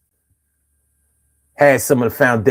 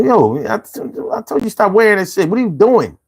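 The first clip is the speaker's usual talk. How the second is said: mock scolding; 7 semitones higher than usual. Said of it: "I'm like".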